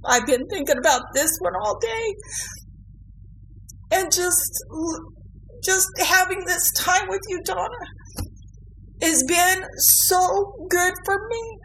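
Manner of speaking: high, tearful, voice breaking; crying. Real, not acted. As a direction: speak through real tears. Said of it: "I'm sorry I'm crying today".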